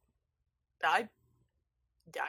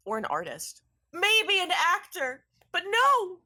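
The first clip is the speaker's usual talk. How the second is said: dramatic lament; 5 semitones higher, 7 dB louder than usual. Acted. [name] leaning on the cabinet, theatrical lament. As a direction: ham up the tragic confession. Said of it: "dramatically leans against the cabinet".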